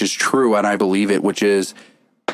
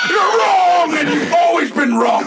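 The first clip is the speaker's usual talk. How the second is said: heel promo shout. Pretend. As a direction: scream it at the crowd like a heel. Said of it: "If I'm a heel".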